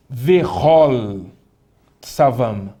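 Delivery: "Vehol" loud, with an open O, pausing before Tsavam; leitura com O. Shown as a demonstration. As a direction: say Ve-HOL with a round open O, word by word. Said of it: "Eu vou ler com som de O". ("Vehol" com som de O aberto, palavra por palavra)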